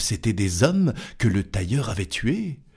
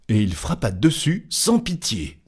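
plain reading voice

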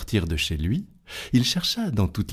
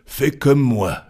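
slow, louder challenge; challenging giant; 5 semitones higher, 6 dB louder, slower than usual. Acted. Giant daring the tailor, slow and louder. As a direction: dare him, heavy and slow.

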